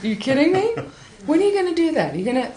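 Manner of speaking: can't believe what you're hearing; incredulous. Retold or imitated. Teacher reacting to the tongue idea in disbelief. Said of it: "I was like".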